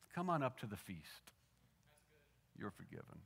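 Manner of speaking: soft, low and tender welcome; quiet pardon with a gentle smile; gentle invitation. Retold or imitated. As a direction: invite them gently, then drop almost to a hush. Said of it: "Jesus saying with a gentle smile".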